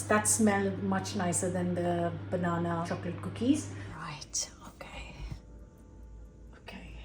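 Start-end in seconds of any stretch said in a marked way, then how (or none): 4.0-7.0 hushed, bracing for the taste; tiny whispered countdown; nervous whisper. Real, not acted. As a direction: whisper to yourself before diving in. About to taste the result.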